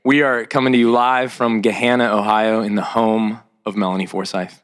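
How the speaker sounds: ordinary talk, nothing marked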